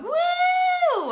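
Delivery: high loud whoop; mock cheering woo. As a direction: whoop like a hyped-up crowd. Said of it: "screaming and hollering".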